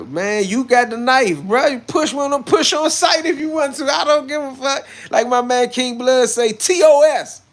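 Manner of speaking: very high, loud rant; hyped-up shouting. Real, not acted. Streamer daring viewers to push on sight, hyped-up shouting. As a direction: shout it high, building to a yelled payoff.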